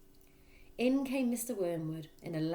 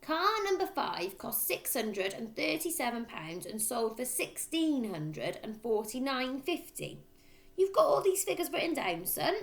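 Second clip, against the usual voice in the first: dictating father; 7 semitones higher, 3 dB louder than usual. A narrator's reading. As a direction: spell out each figure like an order.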